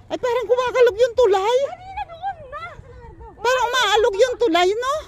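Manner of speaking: high, loud and nervous; alarmed as the bridge sways; on a wobbly bridge. Real, not acted. Walking on a shaking bridge.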